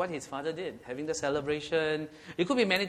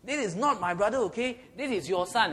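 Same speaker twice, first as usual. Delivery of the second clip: angry; 5 semitones higher, 4 dB louder than usual. Retold or imitated. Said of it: "it's because they are angry".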